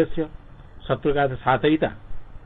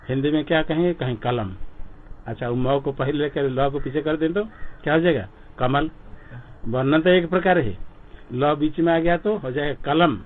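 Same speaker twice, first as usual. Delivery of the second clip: ordinary talk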